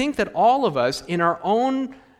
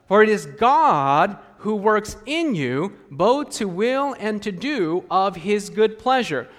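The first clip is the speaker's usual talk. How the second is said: scripture reading; 3 semitones higher than usual. Acted.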